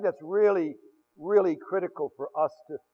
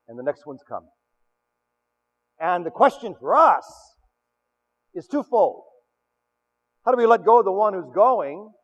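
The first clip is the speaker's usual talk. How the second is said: ordinary talk, nothing marked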